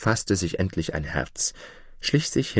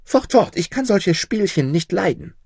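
much higher and louder, shooing him away; frightened shout; 12 semitones higher, 4 dB louder than usual. A narrator's reading. Mother shouts the dwarf away in fright. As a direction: cry out to drive him off. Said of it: "rief sie".

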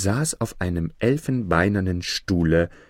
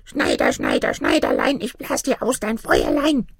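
tiny little man; 6 semitones lower than usual. A narrator's reading. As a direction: chant it teasingly as a tiny creature. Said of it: "ein winzig, winzig kleines Männchen und sagte". (winziges Männchen mit hoher Piepsstimme)

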